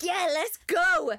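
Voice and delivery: loud, drawn-out cheer; loud slow cheer. Retold or imitated. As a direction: cheer your partner on, slowly. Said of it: "Alex is like".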